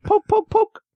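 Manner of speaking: high squeaky silly voice; shrill screeching bird woman. Pretend. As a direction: poke at it in a squeaky voice. Silly eagle bit, poking.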